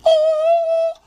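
high, loud triumphant Oh!; triumphant exclamation. Retold or imitated. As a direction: yelp of victory, way up high. Reacting to destroying heckler.

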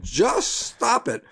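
slow, high, deadpan just stop it; Bob Newhart's deadpan. Retold or imitated. Man imitating Bob Newhart's psychologist, slow dry deadpan. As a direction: drop it flat and slow like a dry TV shrink. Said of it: "that was his response".

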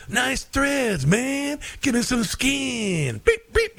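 high, hip jive voice, slow on give me some skin; Huggy Bear voice. Retold or imitated. Huggy Bear greets Starsky, high jive voice. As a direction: ham up a seventies street hustler greeting. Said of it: "Huggy Bear goes".

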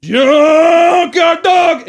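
high, slow, loud shout; junkyard dog shout. Shown as a demonstration. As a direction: roar the catchphrase. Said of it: "real close, and I'd be like".

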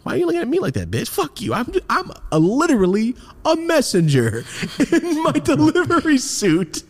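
high-pitched, squeaky-voiced protest; indignant mock rant. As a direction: plead your innocence in hammed-up outrage. Acted indignant rant while driving away.

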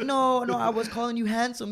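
lightly voiced woman, pitched high; imitated backpedalling woman. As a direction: mimic a high-pitched, placating denial.

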